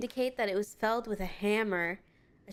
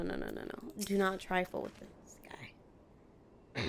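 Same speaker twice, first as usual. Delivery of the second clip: slow ominous whisper; 3 semitones lower, 7 dB quieter, slower than usual. Acted. Streamer warning chat about the goldsmith, whispered. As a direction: hush it like a dire warning.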